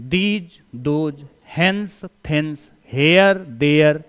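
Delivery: each word pulled out slowly; very slow list for copying; very slow dictation. Shown as a demonstration. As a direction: dictate the word pairs one by one. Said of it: "जैसे today".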